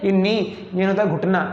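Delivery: ordinary talk, nothing marked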